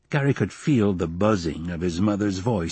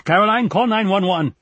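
high, loud shout to another room; exasperated full-voiced shout; raised voice; 11 semitones higher, 5 dB louder than usual. A narrator's reading. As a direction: yell the instruction to your wife. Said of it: "Gary raised his voice".

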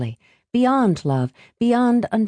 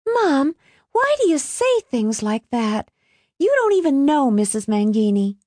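put-on higher-pitched girl's protest; protesting daughter; 9 semitones higher than usual. A narrator's reading. Alice challenges her mother at dinner, protesting.

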